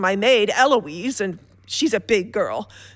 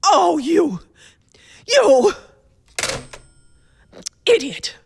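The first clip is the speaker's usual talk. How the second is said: furious outburst; 5 semitones higher than usual. Acted. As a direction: spit the insult after the hang-up. Hangs up on the police officer.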